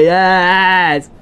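loud, high triumphant shout; excited cheer. Real, not acted. Gamer cheering his dog in a fight, loud excited shout. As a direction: cheer the dog's catch.

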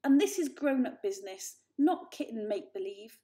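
loud, dismissive put-down; scornful older brother. A narrator's reading. Julius dismissing Varjak's story, loud and scornful. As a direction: talk down to him hard. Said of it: "snarled Julius".

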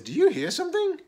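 high, loud squirrel voice; alarmed question. A narrator's reading. Brown Squirrel hears cookies snapping, alarmed. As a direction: ask it in high-pitched alarm.

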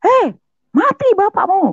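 very high, loud retold shriek; imitated mother's scream. Mother seeing son grip father's neck, screaming. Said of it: "Sampai ibu saya teriak".

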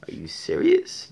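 shoots way up high in disbelief; are you kidding me, outraged; incredulous. Real, not acted.

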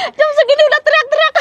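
laughing. Real, not acted. Laughing at big breakfast.

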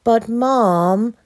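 put-on high, whiny boy's protest; grumpy child pleading sick. A narrator's reading. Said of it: "Olu replied grumpily".

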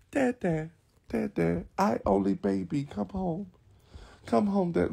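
put-on high, soft baby voice; pretend pleading child. Only baby begging Dad to come home, pleading. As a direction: whimper it small and high.

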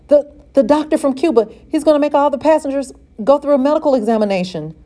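panting, fast and high, words tumbling out; out of breath from running to his mother; breathless. A narrator's reading. Boy out of breath bursts in to tell his mother. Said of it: "still panting from his run".

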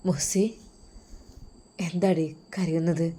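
soft, concerned husband's voice; husband softly asking his crying wife what is wrong; gentle husband. Acted. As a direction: ask tenderly why she is crying.

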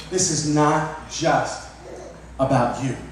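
very slow, word by word; slow emphatic repeat. Shown as a demonstration. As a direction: drag out "This is not just" with weight. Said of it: "Let me repeat that again".

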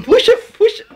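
high, laughing pull pull; laughing shout. Real, not acted. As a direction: laugh it out as you urge. Urging a pull while laughing.

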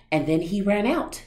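ordinary delivery, nothing marked

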